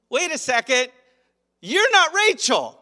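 hammed-up morning-after shock; shocked realisation. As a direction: stare in horror at the wrong bride. Jacob waking beside Leah.

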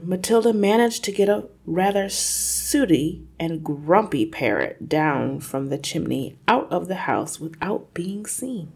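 usual speaking voice

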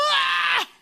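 loud, high-pitched comic scream; exaggerated high yell; mock panicked scream. Acting out the panicked wait.

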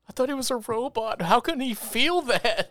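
high-pitched, incredulous viewer; mock dismay. Acting out a dismayed viewer's reaction.

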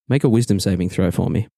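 ordinary delivery

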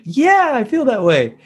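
sing-song voice. Shown as a demonstration. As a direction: stretch it out in a bouncy melody. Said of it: "the whole sing-song voice".

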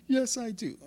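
very high mocking answer; mimicked complainer. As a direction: squeak the answer as the indignant complainer.

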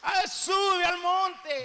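drawn-out preaching shout; slow shouted command. Acted. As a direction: call it out slowly.

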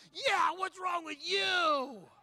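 high, loud, long-drawn yell; triumphant accusing shout. Retold or imitated. Preacher backing up onlookers against the man, drawn-out shout. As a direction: echo the crowd, stretching every word. Preacher joins the crowd's reproach.

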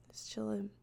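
breathy whisper, no voice; whispering it to herself; whispered aside. Shown as a demonstration. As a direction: drop to a secret whisper. Whispered repeat of the line.

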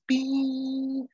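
high, ringing held note; imitated sound effect. Recapper imitates the noise before the fall, high ping. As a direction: mimic a high-pitched ping. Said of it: "we hear the noise".

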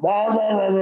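gag it down with high wah sounds; mock gagging noises. Forces down the monkey brain.

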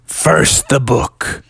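slow guardian ultimatum, sinking low; spooky drawn-out voice dropping to a rasp; scary voice. Acted. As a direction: lay down terms slowly and menacingly. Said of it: "your voice is pretty scary".

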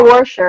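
"washer" said warsher. Retold or imitated. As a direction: put an R before the sh in "washer". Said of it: "we have the R".

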